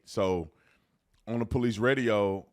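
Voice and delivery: usual speaking voice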